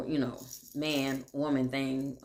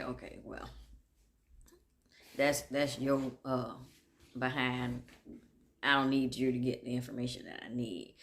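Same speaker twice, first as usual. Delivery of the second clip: muttered aside; 3 semitones lower, 5 dB quieter than usual. Retold or imitated. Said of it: "So I just was like".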